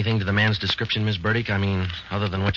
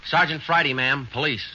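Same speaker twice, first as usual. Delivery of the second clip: raised and higher to carry through a door; calling through door; 7 semitones higher than usual. Acted. Detective announcing himself through her door, raised voice. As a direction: project through the closed door.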